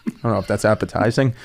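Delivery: low, fast, laughing mumble; nervous laugh. Retold or imitated. Comic retelling his nervous reply to the knife threat, laughing. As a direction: chuckle it out nervously.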